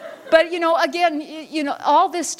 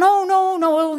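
shocked dismay; 10 semitones higher, slower than usual. Retold or imitated. Man at the bar reacts to her car, high shocked dismay. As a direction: react like a stranger seeing a disaster.

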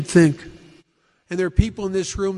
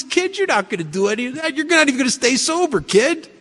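lightly voiced mocking Woody, a bit higher; 7 semitones higher than usual. Sober old-timer Woody scoffing at the boastful kid, lightly mocking. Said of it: "Woody starts laughing at me".

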